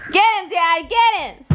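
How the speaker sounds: high excited egging on; cheering on. Real, not acted. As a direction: egg him on, high and bright. Cheering on the tickle fight.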